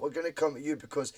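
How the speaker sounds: ordinary talk, nothing marked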